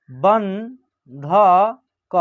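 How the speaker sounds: बंधक very slowly, syllable by syllable, with a rising end; slow dictation of बंधक; broken into syllables. Shown as a demonstration. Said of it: "तोड़ के पढ़िएगा".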